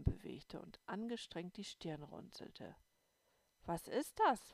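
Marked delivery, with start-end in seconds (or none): 3.6-4.5 high, loud question; curious question. A narrator's reading. Little girl asking her sister, high and curious. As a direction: ask it high and eager. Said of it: "fragte ich sie".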